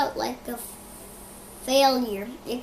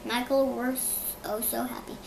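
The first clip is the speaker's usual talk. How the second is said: usual speaking voice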